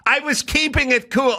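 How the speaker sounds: high-pitched, offended explanation; indignant stranger's voice. Retold or imitated. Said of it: "I hear this voice".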